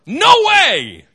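high, loud shouted refusal; mock outraged shout. Employer balking at the asked wage, loud mock shout. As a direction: shout it like an overcharged boss. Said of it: "if they should say".

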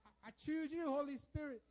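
high, loud hammed-up hero summons; Captain Planet call. Shown as a demonstration. Preacher jokingly summoning the Holy Spirit, loud cartoon-hero call. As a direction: shout it out like a cartoon hero calling his power. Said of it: "Like Captain Planet".

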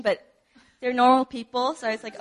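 ordinary talk

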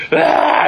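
wordless wail mimicking a frightened child; imitated crying. Father mimicking his son's reaction to a splinter, mock crying. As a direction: cry out like a kid in a panic. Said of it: "He started crying. He's like".

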